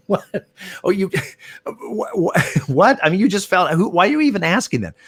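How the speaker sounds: incredulous. Retold or imitated. Umpire retelling his baffled reply to the player, high, incredulous outburst. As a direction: play the stunned umpire who can't believe the question.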